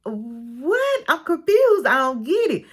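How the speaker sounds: high-pitched mock confusion; acted bafflement. Wife hearing what they might say about him, acted bafflement. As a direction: play a wife thrown by the news.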